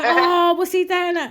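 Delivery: mock heckle at the telly; shouting at telly. Football fan reacting as if at the telly, mock exclaiming. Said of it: "as you would at the telly".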